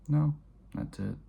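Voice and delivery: high and loud, cutting it short; sharp loud dismissal. Retold or imitated. Father cutting off his son's question, sharp and loud. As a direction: snap it closed.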